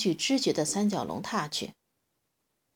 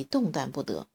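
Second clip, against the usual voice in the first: usual speaking voice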